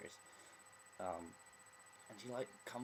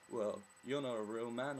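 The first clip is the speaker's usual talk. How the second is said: slightly put-on, loud dismissive quote; mock gatekeeper; 3 semitones higher, 7 dB louder than usual. Imitating someone policing gender, mock judgemental. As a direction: lightly imitate someone judging, louder. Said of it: "who would be like".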